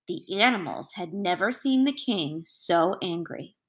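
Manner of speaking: plain reading voice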